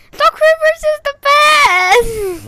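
hammed-up high Irish shout; shouting it in a bad Irish brogue; mock Irish accent. S1 mocking Irish viewers, shouting in a fake Irish accent. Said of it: "in Ireland accent".

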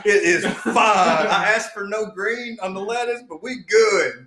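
slow, drawn-out comic reassurance; hammed-up, stretched-out "all good" voice; pretend happy customer. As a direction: play a customer slyly waving off a mistake. Plays delighted wrong-car driver getting cash.

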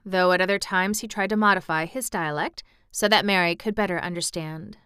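plain reading voice

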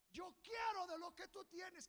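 high, loud proclaiming cry; preacher shouting the prophet's words; shouted quote. Retold or imitated. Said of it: "le dijo".

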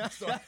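high, loud bursts of laughter; real helpless laughter, loud and high; loud laughing fit. Real, not acted. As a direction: break up laughing hard.

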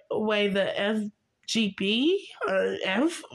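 usual speaking voice

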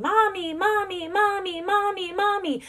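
put-on high, repeated toddler whine of mommy; toddler calling mommy. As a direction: imitate a toddler calling for mom. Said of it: "she kept, you know, saying".

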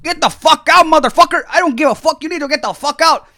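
loud, high furious yell; retold cursing shout; imitated angry kitchen guy. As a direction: bellow him out of the place. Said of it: "I'm having a screaming match".

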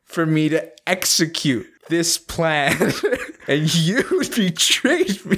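high, slow, laughing wounded complaint; mock betrayal. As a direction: ham up feeling betrayed by a friend. Hammed-up betrayed friend.

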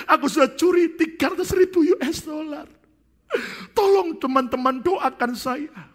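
high, loud crying plea; tearful confession. Acted. Second pastor confessing theft to friends, high tearful crying. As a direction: weep through the confession. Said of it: "Pendeta yang kedua mendengar gitu".

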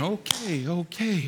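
soft, underwhelmed shrug of a reply; unimpressed. Acted. Preacher mimics someone used to the Grand Canyon, unimpressed. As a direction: toss it away quietly, as old news.

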